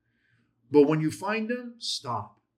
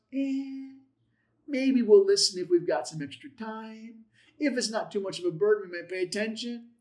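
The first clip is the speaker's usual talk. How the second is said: higher, hedging mock reluctance; noncommittal, half-willing; 6 semitones higher than usual. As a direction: lukewarm maybe-we-will tone. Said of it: "It's emphatic. It's not".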